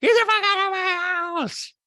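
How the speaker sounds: high, loud, drawn-out yell; Rinna. Retold or imitated. Podcaster replaying a housewife's outburst, mock yell. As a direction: imitate an outburst at full pitch. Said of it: "flashback to her actually saying that".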